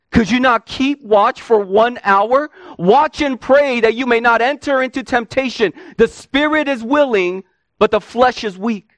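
Jesus scolding Peter for falling asleep; rebuking Jesus. Acted.

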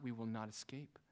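ordinary delivery

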